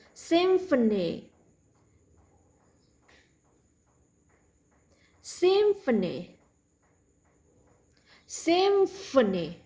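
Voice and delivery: Symphony slowly with clear f; careful f in Symphony; slow drill. Shown as a demonstration. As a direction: model the ph as f, slowly. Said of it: "Same thing in next word".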